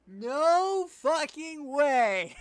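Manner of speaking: loud stunned disbelief; shocked shout. Real, not acted. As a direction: blurt it out in shock. Shocked by the song's twist.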